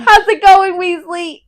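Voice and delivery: loud, high hollered hello; hammed-up rowdy welcome; boisterous greeting. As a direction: call out heartily to a visitor. Hagrid greets Ron at the door.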